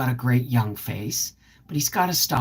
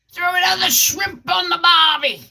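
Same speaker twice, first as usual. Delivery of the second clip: higher, loud, hammed-up Australian accent; bad Australian accent; 4 dB louder than usual. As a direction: shout it like a cartoon Australian at a barbecue. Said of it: "I have a horrible Australian accent".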